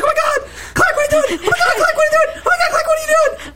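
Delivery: very high-pitched, hammed-up frantic cries; mock a panicked Lois, racing through the repeats; acted Lois panic. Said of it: "And she's like".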